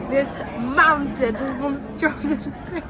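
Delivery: drawn-out slow, mock awe; slow emphasis. Real, not acted. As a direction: stretch each word out in amazement. Describing huge hot chocolate.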